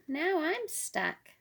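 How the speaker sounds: high, slow mock-stuck voice; playful mock dismay. Daddy Squirrel stuck in mud tells Little Squirrel, mock dismay. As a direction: ham up being stuck to make him laugh.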